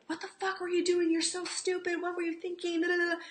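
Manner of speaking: high, loud mock yell of self-blame; inner critic screaming. Voicing the blaming voice in her head after a burn, loud shriek. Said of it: "that screaming in my head".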